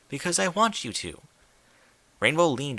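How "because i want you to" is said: refined lady. Acted. Rarity insists.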